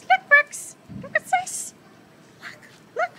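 very high squeaky dog-talk. Real, not acted. Calling the dog to a toy.

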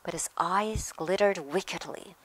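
plain reading voice

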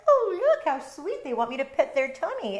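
high, gushing coo over the cat's belly; mock sweet cooing. Owner cooing at a cat showing its tummy, mock sweet. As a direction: gush like a smitten owner falling for the trap. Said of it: "you're like".

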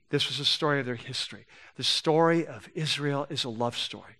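low, soft, solemn reassurance; tender, earnest preaching. Real, not acted. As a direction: drop low and quiet, letting the love sink in. Preacher turns to God's patient love.

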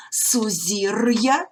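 сузір'я said very slowly with hard р then й-а; separated pronunciation. Shown as a demonstration. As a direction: stretch сузір'я so the apostrophe break is heard. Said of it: "твердий р, й, а звуки".

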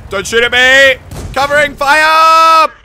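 loud high commands; shouting orders over battle. Real, not acted. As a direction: yell orders mid-fight.

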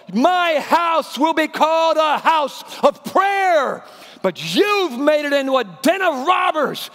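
slow, high, loud denunciation; yelling. Acted. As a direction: thunder it at the robbers. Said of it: "And he yells".

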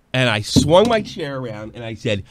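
ordinary talk, nothing marked

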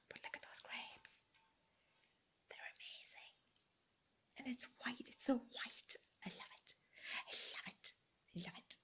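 hushed adoring whisper. Real, not acted. Whispering love for the grapes platter. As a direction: whisper it like a secret.

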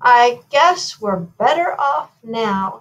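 understated regret, taken slowly; mildly sad. A narrator's reading. Queen reflects once the goat is gone, mildly sad. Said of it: "said the queen sadly".

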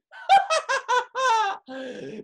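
high, loud villainous cackle; maniacal laugh. Acted. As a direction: cackle like a scheming wife. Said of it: "Sally laughed maniacally".

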